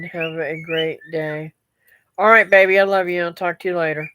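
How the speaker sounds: ordinary delivery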